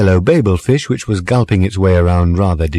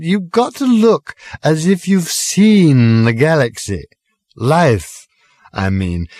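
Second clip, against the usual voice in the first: put-on drunken ramble; 8 semitones higher than usual. A narrator's reading. Drunk Arthur explains.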